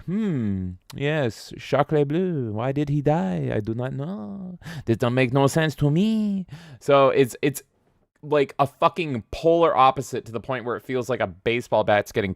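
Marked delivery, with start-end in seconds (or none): 0.0-6.5 mock French accent. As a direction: ponder quietly in a put-on French accent. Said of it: "this one is like".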